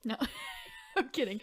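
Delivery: breaking into giggles; laughing. Real, not acted. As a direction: laugh it off as a joke. Laughs off her joke.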